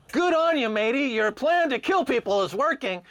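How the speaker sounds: high, mocking cheer; sarcastic congratulation. Mocking the profiteers with a sarcastic high cheer. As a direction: toast them with fake praise, dripping sarcasm.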